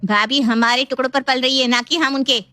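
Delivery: high, scornful protest; defiant sister-in-law. Acted.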